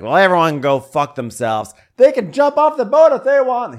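loud rant climbing to a high yell; mock angry shouting. Drunk guest raging at everyone aboard, mock shouting. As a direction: imitate a tantrum building to a shriek.

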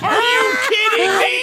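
high cracking outraged cry; mad, near tears. Real, not acted. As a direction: cry out in disbelief. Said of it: "That's so funny how mad you are".

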